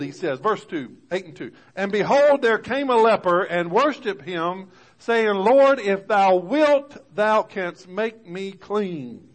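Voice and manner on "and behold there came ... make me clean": loud, high scripture reading, slowing on the plea; preaching at full force. Acted. Preacher reads the leper's plea to the church, loud and slow. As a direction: proclaim the verse to the congregation.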